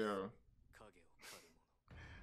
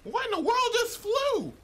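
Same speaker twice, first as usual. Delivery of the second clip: stunned shout; 6 dB louder than usual. Real, not acted. Watching an anime fight, blurting out in astonished shock. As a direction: shout in disbelief, pitched much higher and louder.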